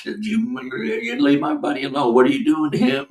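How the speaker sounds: a light mock of a kid close to tears; a man retelling what he shouted while hypnotized; imitating a frantic child. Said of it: "talking like eight-year-old [name]".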